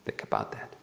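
near-voiceless, very soft murmur; hushed aside. Real, not acted. Preacher asking the congregation to reflect, hushed aside. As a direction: drop right down to a whisper.

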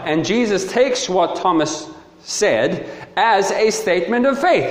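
ordinary talk

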